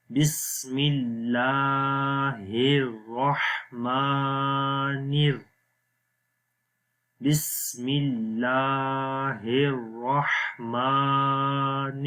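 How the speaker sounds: very slow melodic tajweed chant; Quran recitation. Shown as a demonstration. Teacher recites it whole for students. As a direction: recite it long and sung. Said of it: "اب پورا پڑھیں گے".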